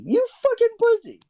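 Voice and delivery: hammed-up outrage at a coward; mock yell. Real, not acted. Taunting Robert for not helping, high mock yell.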